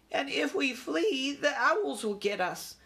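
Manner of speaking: high, drawn-out cry of dread; alarmed mother. A narrator's reading. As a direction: wail the warning as a worried mother. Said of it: "said Ralph's mother".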